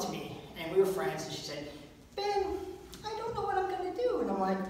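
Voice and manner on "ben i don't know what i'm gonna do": put-on much higher girlish fretting voice; female friend. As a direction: voice the worried girl, high and soft.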